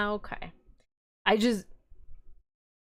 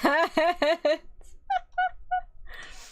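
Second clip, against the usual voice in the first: high burst of laughter; 9 semitones higher than usual. Real, not acted. Laughs at her own ad worry.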